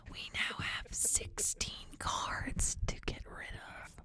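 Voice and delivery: slow whisper into the mic; right in your ear. Acted. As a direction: breathe it close to the mic. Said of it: "Can you hear me in your ear?".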